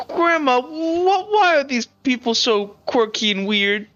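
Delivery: put-on high, drawn-out small child voice; high child voice, slowing as the question goes on; little girl. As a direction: ask grandma as a puzzled little girl. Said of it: "The, the little girl".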